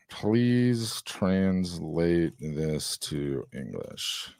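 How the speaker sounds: very slow, drawn-out words; typing aloud. Real, not acted. Host typing a prompt for the AI, speaking very slowly. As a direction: stretch each word as you type it.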